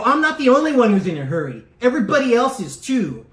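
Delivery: loud, fast retort; sharp tone. Retold or imitated. Speaker to the bilingual ladies, loud sharp retort. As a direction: snap back at them loudly. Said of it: "in that tone".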